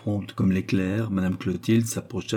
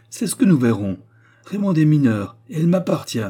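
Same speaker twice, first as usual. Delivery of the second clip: mère possessive, voix forte qui monte; défi furieux; 7 semitones higher, 6 dB louder than usual. A narrator's reading. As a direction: affirmer son droit en haussant le ton. Mère défiant le mari dans une querelle. (défi furieux)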